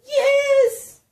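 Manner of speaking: put-on high, loud boy's yell; desperate little boy's shout; yelled yes. A narrator's reading. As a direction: yell it out in a panic. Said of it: "Then William yelled".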